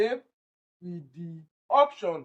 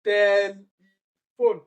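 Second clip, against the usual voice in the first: "Telephone" with loud, long TEL; heavily stressed first syllable; 7 dB louder, much slower than usual. Shown as a demonstration. Teacher proves tel is stressed, slow and loud. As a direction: punch and hold TEL in "Telephone". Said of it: "It is also tel that is stressed".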